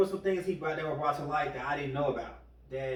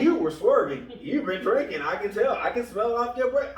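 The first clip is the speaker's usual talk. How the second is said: loud, raised imitation of an accusing cop; playful cop voice, pushed louder; mock accusation; 6 semitones higher, 8 dB louder than usual. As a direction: lay out the charges like an officer. Acting out cop accusing a driver.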